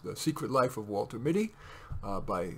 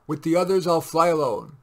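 raised captain; 3 semitones higher, 7 dB louder than usual. A narrator's reading. Captain over gunfire.

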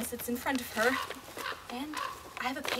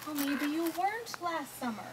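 ordinary delivery

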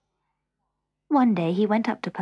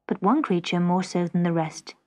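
plain reading voice

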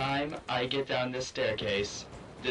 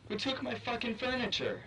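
startled outrage; 10 semitones higher, 3 dB quieter than usual. Real, not acted. House suddenly emptied in game.

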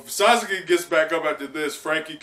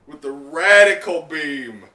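loud, high, laughing shout; excited shout; 5 semitones higher, 12 dB louder, slower than usual. Real, not acted. Reader cheers Frankie's hit with a loud, high shout.

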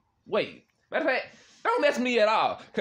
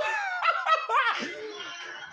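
a sudden shrieking laugh, pitched high. Real, not acted. Reacting to strangers on camera.

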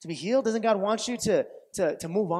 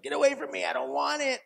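mock rejection; 7 semitones higher, faster than usual. Acts out rejecting help.

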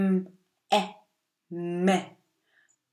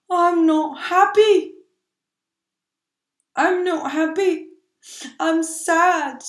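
high sad little voice, louder; sad child; 8 semitones higher, 4 dB louder than usual. Acted. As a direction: play Max the sulky kid. Max answering, a sad child.

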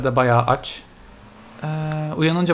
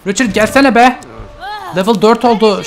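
higher, louder calling; exasperated yell; 7 semitones higher, 6 dB louder than usual. Real, not acted. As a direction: yell at him to come. Yelling at sim. (sinirle yüksek sesle seslenerek)

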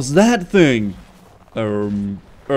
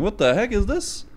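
normal talking voice

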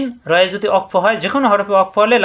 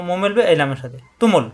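normal talking voice

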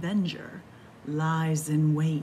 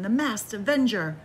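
ordinary delivery, nothing marked

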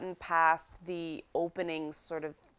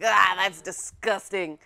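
louder mock revulsion; imitated disgust; 6 dB louder than usual. Player mimicking the game's hero skinning an animal, mock disgust. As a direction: play up a grossed-out reaction. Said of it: "it was like".

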